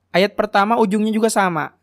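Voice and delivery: ordinary talk, nothing marked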